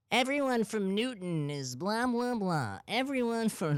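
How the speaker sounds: exaggerated high Boston accent, drawn out; mocking TikTok Boston townie, Massachusetts towns video, forced accent. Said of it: "I do, like, a forced accent".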